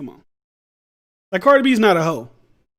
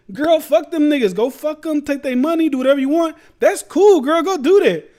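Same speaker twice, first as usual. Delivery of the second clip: higher, louder mock cheer; hyped girlfriend voice; 7 semitones higher, 3 dB louder than usual. Imitating a friend hyping up a girl, higher and louder mock cheering. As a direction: egg her on like a hype friend.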